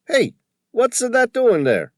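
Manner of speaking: high, loud, excited Tony voice with a put-on accent; an indignant shopkeeper squawking about the photo; riled old Tony. A narrator's reading. Shopkeeper upset at the vandal, loud high indignant voice.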